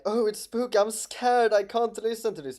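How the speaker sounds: high, fast mock fright; mock scared. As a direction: ham up being scared. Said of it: "I'm not gonna act like".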